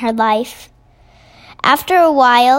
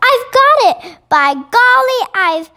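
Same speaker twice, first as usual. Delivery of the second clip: high, bursting exclamation, easing slightly; excited cry; 7 semitones higher, 3 dB louder than usual. A narrator's reading. Sophie suddenly finds a plan, crying out excitedly. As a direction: cry out with a sudden brainwave, pitched way up. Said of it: "in a voice filled with excitement".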